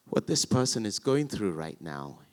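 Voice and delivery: ordinary talk, nothing marked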